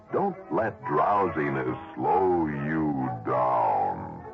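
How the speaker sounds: ordinary talk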